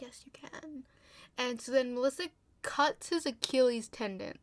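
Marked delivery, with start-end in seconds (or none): 0.0-0.8 soft, higher mock-sinister whisper; coaxing whisper. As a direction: whisper it as retold urging. Retold coaxing reply to reluctant girl.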